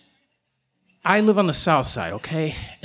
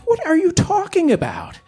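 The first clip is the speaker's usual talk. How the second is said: high-pitched, incredulous question; baffled protest; 11 semitones higher than usual. Retold or imitated. Man questions a talking coyote, baffled and high. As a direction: pitch shoots up in disbelief.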